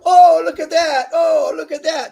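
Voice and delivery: loud, very high mock amazement; mocking performers. Host imitating clowning porn performers, mock-amazed high voice. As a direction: hoot it in exaggerated high-pitched wonder. Mimics performers showing off, "they go.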